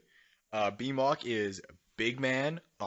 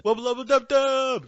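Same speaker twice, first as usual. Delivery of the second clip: high, loud shouted catchphrase; wacky full-volume cartoon holler; catchphrase yell; 13 semitones higher, 10 dB louder, slower than usual. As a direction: belt it out high, stretching the last word.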